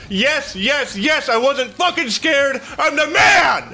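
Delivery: high, exultant yells building to a roar; triumphant shouting. Real, not acted. Player boasting he wasn't scared after winning, high triumphant shout. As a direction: crow over the win at full voice.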